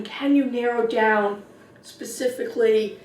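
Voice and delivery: ordinary delivery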